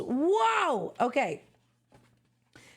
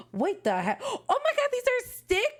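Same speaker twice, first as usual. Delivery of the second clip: much higher, thrilled discovery; excited squeal; 11 semitones higher than usual. Real, not acted. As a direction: squeal it with delight. Finds stickers in the album.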